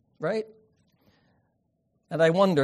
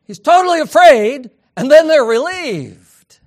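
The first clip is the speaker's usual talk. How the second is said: emphatic preaching; 10 semitones higher, 13 dB louder than usual. Acted.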